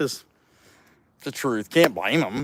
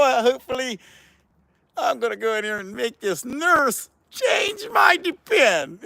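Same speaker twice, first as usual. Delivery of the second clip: put-on higher, slow, odd cranky old man voice; grumpy old man voice; 8 semitones higher, slower than usual. A vlogger pretends to be a future grumpy old man, higher and slow. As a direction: play a doddering old man grumbling about his nurse. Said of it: "we're all gonna be that guy".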